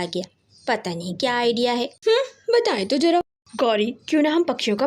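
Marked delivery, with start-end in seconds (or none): 1.8-3.2 skeptical tease. A narrator's reading. Wife skeptical, teasing husband.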